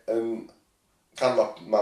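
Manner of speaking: ordinary delivery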